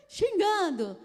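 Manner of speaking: loud, high, punched out; scolding shout. Acted. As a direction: throw it out like a telling-off. Acting out the scolding officer. (bronca em voz alta e aguda)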